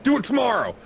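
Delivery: high yell down the stairs. Retold or imitated. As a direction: call down to the electrician, high and loud. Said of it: "I yelled down to the electrician".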